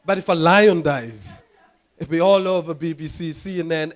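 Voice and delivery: usual speaking voice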